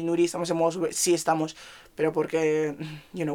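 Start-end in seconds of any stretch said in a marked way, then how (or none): none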